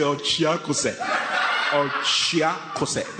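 slow, drawn-out taunt; mocking retort. Pastor answering the crying caller, slow mocking retort. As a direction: stretch each syllable out for the laugh.

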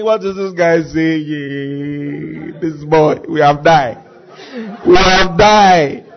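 low, drawn-out groaning dismay; Saul in dismay. Acted. Onlooking king to himself about the boy, dismayed. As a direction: drag it out low, as if doomed. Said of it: "Saul is saying".

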